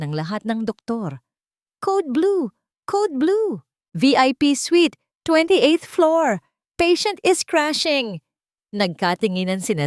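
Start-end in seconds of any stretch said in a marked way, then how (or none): 1.8-8.2 shouted much higher and louder, slow and urgent; urgent alarm call. A narrator's reading. Nurse shouting the Code Blue alert down the hallway, urgent high shout. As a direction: call it out across the ward in panic.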